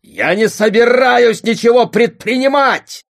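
high furious shout. A narrator's reading. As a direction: yell it out in anger. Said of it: "крикнул отец".

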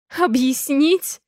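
a bitter half-laughed question; hysterical laugh. A narrator's reading. Mother mocks his excuse, hysterically. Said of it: "Смеюсь истерично".

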